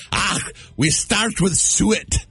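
a playful Scottish brogue for the recipe; Scottish accent. Acted. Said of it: "does a wicked good Scottish".

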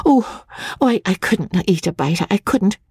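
high, breathless stammering plea; nervous bride asking the clerk for help; timid young wife. A narrator's reading. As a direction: stammer it out, half whispered. Magnolia protests tearfully.

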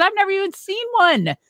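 high, incredulous protest; disbelieving surprise. Retold or imitated. Telling Mike she has never seen one, high incredulous surprise. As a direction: play up the disbelief of the newcomer. Retelling disbelief at job offer.

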